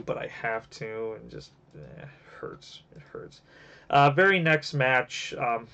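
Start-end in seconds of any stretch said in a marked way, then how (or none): 0.0-3.4 fading to a near-whispered "it hurts"; heartbroken. Real, not acted. Reviewer dreading a sad match, whispering her hurt. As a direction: let the voice sink until it's barely there. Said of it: "it breaks my heart".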